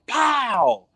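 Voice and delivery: explosive pow sound; shotgun bang. Retold or imitated. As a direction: punch out a gunshot noise. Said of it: "it sounded like a shotgun going off".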